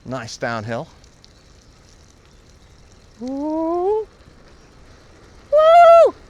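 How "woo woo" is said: high whooping yell of joy; excited woo. Real, not acted. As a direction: whoop loud down the hill. Riding a fast downhill.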